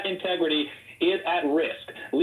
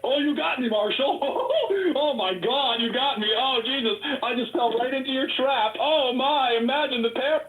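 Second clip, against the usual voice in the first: much higher, hammed-up "oh you got me" with a laugh; mock gotcha sarcasm; 8 semitones higher than usual. Candidate mocking rival Marshall's accusation, sarcastic high mock dismay. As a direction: play it as mocking fake defeat. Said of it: "It wasn't a fucking trap, bro".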